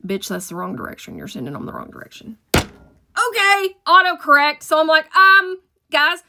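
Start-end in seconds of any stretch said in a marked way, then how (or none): 0.1-2.4 softer, low and quick correction; sister's low warning. Retold or imitated. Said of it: "she's like". 5.2-6.2 high, loud, sheepish; sheepish call. Retold or imitated. Correcting herself on radio.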